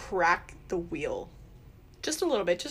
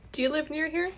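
raised character voice; 8 semitones higher than usual. Acted. Player asks in character.

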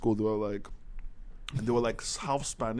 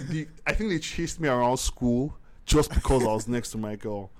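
ordinary delivery

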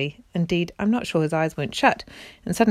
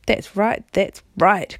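mild growl of agreement; 4 dB louder, slower than usual. A narrator's reading. Other dwarfs backing Griffle, growling. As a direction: growl it as a grumbling crowd. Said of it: "growled the other dwarves".

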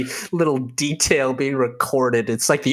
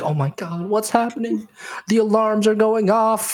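high-pitched panicking receptionist; freaking out; 9 semitones higher than usual. Acted. Receptionist faced with a collapsed guest and alarms, freaking out. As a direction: play a flustered receptionist losing it. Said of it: "She's freaking out".

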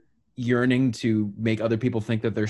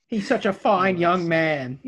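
put-on slow, higher, louder doting old lady; doting grandma; 8 semitones higher, 5 dB louder, slower than usual. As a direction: coo it like a proud grandma. Mocking a doting relative.

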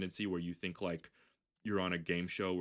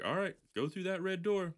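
much higher hammed-up instruction; Andy Samberg instruction; 11 semitones higher than usual. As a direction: point the way in a mock voice. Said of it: "And he's like".

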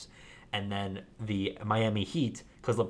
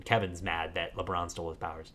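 ordinary talk

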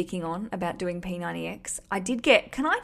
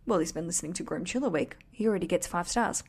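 normal talking voice

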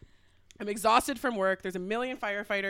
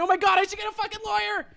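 higher, fast panicked rant; fast high mock panic; freaking out; 10 semitones higher, 3 dB louder, faster than usual. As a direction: spiral into panic. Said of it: "Freaking out".

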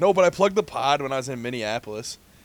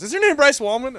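excited stranger; 11 semitones higher, 6 dB louder than usual. Retold or imitated. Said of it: "And she goes".

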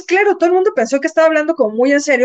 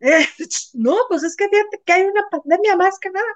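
higher, sheepish put-on voice; mock reply; 6 semitones higher than usual. Host acting out her own awkward answer to the accuser, higher voice. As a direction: play the awkward excuse lightly. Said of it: "Y tú así de".

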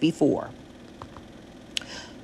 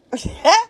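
high squeaky giggle escaping; a burst of held-in laughter; stifled giggle; 12 semitones higher than usual. Real, not acted. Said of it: "I'm trying not to laugh".

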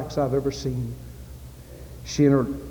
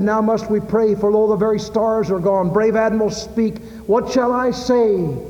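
higher, louder recited poem, slowing at the end; poem recital; 7 semitones higher, 5 dB louder than usual. Acted. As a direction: declaim the mate's plea with rising urgency.

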